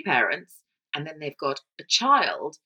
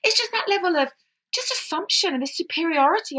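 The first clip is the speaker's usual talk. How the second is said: flustered backpedal; 4 dB louder than usual. Real, not acted. Flustered backpedalling after laughter.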